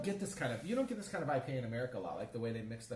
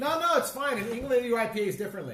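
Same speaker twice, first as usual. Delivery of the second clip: loud, raised to carry across the room; calling out; 8 semitones higher, 8 dB louder than usual. Real, not acted. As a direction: call over to someone away from you.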